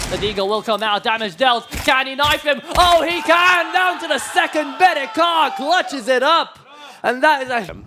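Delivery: building to a roaring shout; esports caster. Real, not acted. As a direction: scream the clutch call, pitch soaring. Caster calls a knife clutch.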